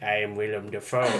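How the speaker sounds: hammed-up creepy villain introducing himself; Willem Dafoe. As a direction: do a mock-sinister actor impression. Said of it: "made him more creepy in a way".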